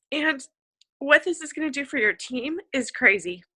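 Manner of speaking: pushing words out while crying. Real, not acted. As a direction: talk through real crying. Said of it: "I didn't even mean to say that or cry".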